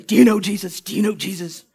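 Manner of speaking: loud, pressing question; pushy loud question. Retold or imitated. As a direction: corner them with it loudly. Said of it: "They're chasing down everybody".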